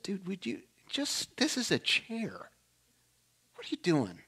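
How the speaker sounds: soft, slow inner reprimand. Retold or imitated. His better self scolding him, soft and slow. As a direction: gently talk yourself down. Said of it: "a different voice from a different part of me".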